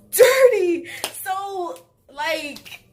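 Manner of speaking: high, loud disgust. Real, not acted. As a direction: sound grossed out by him. Disgusted about the dirty sheets.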